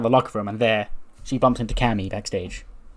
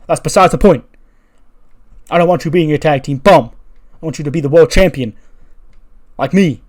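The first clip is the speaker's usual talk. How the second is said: loud, high, pushy ranting; angry rant; 4 semitones higher, 11 dB louder than usual. Acted. Uncle berating nephew about his tag partner: loud angry rant. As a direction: lay into him and don't let up.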